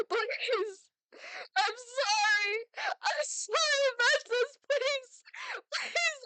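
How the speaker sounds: high, loud, slow wailing sorry; sobbing apology. Acted. Mother sobbing apologies over her daughter. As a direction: break down begging forgiveness. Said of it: "Yuki apologized over and over".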